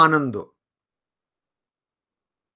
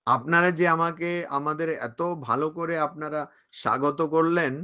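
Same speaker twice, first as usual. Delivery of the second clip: ordinary talk, nothing marked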